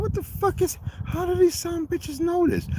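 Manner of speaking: high, incredulous what-the-hell; retelling his shock that they could tell; astonished. Retold or imitated. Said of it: "I said".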